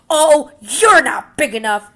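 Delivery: loud, high, dismissive sneer; scornful brush-off. Acted. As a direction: wave him off to save face. Grouchy ladybug backs off.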